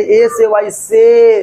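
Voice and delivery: letters drawn out slowly; teacher spelling out the word for students, slowly; slow spelled out. Shown as a demonstration. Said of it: "teacher spelling slowly".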